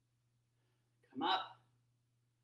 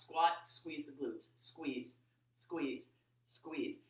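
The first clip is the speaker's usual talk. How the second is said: ordinary delivery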